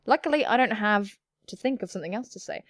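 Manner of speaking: ordinary delivery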